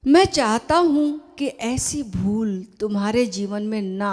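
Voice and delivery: measured, emphatic reading aloud; formal letter reading. Acted. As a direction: read it out deliberately, pausing between phrases. (पत्र का ज़ोरदार, सधा हुआ पाठ)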